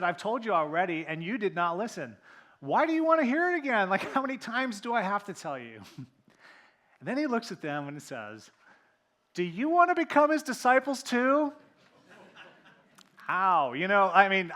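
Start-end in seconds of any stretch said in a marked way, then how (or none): 0.0-5.8 louder, rising to a much higher exasperated cry; exasperated retort. Retold or imitated. Healed man scolding the questioning Pharisees, exasperated. 9.3-11.5 much higher and louder mocking question; sarcastic jab. Healed man taunting the Pharisees, sarcastic. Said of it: "I read the sarcasm in that".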